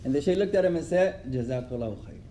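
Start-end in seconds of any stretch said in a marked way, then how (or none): 1.3-2.2 low, softer, calm thanks; calm and peaceful. Retold or imitated. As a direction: say it peacefully, unbothered. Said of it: "When he said it so calmly and peacefully".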